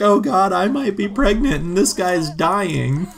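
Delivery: half-joking distraught shout; mock crying wail. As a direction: play a hysterical sobbing friend. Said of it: "Maika's now crying, she's like".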